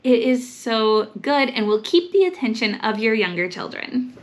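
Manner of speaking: ordinary talk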